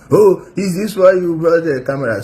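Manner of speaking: high, mocking voice of the defendant; mocking imitation. Commentator mocking the defendant's question, imitating. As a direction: mimic his whining.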